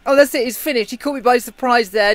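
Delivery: ordinary talk, nothing marked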